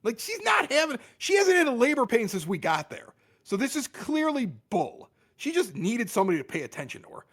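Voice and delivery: high, scornful protest that she's faking; scoffing at the false labor; dismissive insistence. Retold or imitated. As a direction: sneer that it's all for attention. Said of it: "I'm like".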